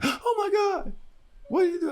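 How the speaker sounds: very high, panicky woman's question; imitated alarm. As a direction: mimic a horrified woman in a high voice. Acts out alarmed woman at home.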